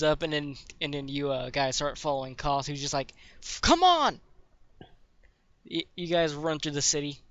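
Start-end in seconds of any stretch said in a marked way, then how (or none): 3.4-4.2 high, loud impatient call; urging shout. Acted. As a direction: yell for stragglers to hurry along. Koth urges the group to follow.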